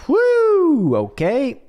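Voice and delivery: high, loud mocking whoop. Mocking talk show hosts, a loud high whoo. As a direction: whoop it up, teasing. Mocking women chatting on The View.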